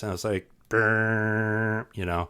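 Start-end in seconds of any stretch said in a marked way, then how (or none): 0.7-1.9 long tuneless buzzing hum; tone-matching attempt. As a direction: badly try to match a keyboard tone. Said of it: "Here, try to match this".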